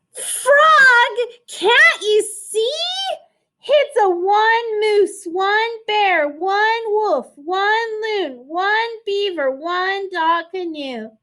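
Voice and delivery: put-on a little girl panicking at her crew; girl's cry of warning. Girl warns the animals the canoe is too small, high-pitched. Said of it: "girl warns the frog".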